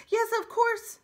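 high, loud, eager; eager robot. Acted. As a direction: answer brightly and eagerly.

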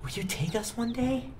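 put-on high, soft little-girl ask. Little sister asking big sister to take her along, pleading softly.

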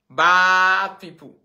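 long, stretched-out loud cry of disgust; slow and loud, like calling out to the crowd; drawn-out loud complaint; mock outrage. Scolding bad women abroad.

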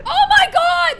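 high, loud panicked cry; alarmed shout. Real, not acted. Friend shouting that the pigs are only sleeping, panicked. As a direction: yell in real alarm at the lifeless pigs.

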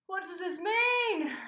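loud, high, slow panicked question; panicking fan after the news. Real, not acted. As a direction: wail the question. Panic stan mode.

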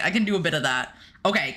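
ordinary delivery

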